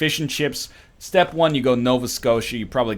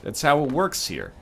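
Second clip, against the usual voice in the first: ordinary talk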